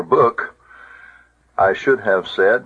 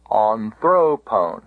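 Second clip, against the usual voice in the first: "anthropone" slowly, THRO stressed, falling after; "anthropone" as an-THRO-pone, drawn out; acute accent on penult; slower than usual. Shown as a demonstration. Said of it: "an acute accent".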